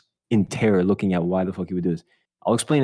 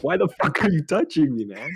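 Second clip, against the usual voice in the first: pitched way up, laughing through it; a mock-terrified squeal; high panicked yelp; 8 semitones higher than usual. To Joey who touched him with dirty hands, high mock-panicked yelp. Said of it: "And I'm like".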